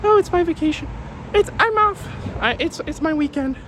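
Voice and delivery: mock him in a high, childish whine; mocking whiny coworker. Mocking the coworker who says he is on vacation, high whine.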